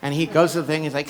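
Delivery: ordinary talk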